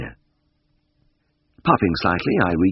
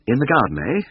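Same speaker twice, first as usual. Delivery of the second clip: a nervous, higher-pitched query; 7 semitones higher than usual. A narrator's reading. Vet questions Missus Beck about the cat, nervously. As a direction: ask with a touch of nerves. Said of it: "I said nervously".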